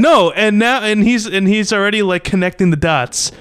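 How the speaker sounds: high-pitched, worked-up protest; exasperated. Real, not acted. Host calling out co-host for contradicting everything, exasperated.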